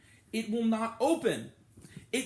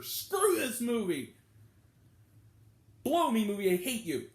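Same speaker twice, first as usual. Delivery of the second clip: angry outburst; 3 semitones higher than usual. Real, not acted. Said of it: "Sorry, I'm angry".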